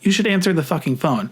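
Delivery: the pushy part of me egging me on; inner voice. Retold or imitated. To himself, a lightly voiced inner urge. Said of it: "a part of me that was like".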